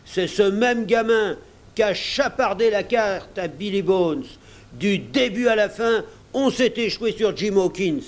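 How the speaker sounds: high, accusing rant; angry cook exclaiming; indignant exclamation. A narrator's reading. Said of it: "s'exclama le cuisinier".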